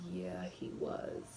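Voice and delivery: imitating a wary boyfriend in a whisper; hushed agreement. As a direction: mumble it low so nobody hears.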